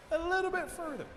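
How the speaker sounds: high sing-out urging; high, wheedling instruction; coaxing request. Acted. Thunder coaxes turtle further back.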